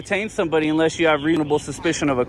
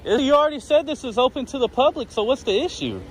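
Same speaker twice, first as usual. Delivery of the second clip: raised pitch, indignant challenge; irritated; 7 semitones higher than usual. Real, not acted. Detained man challenges officers on why he's held, irritated. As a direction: challenge them in a high, fed-up voice. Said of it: "I'm getting irritated".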